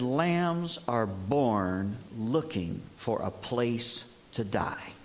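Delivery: slow, hushed and heavy, each word dragging; a farmwife who has given up, weary and low; frustrated, near depressed. Retold or imitated. A farmwife mourning her dead lambs, slow and despairing. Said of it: "in that kind of tone of voice where she's just so frustrated, even verging on depression".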